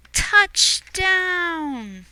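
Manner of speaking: high joyful shout; excited announcer cry; triumphant touchdown. Acted. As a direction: cheer the landing. Landing exclamation.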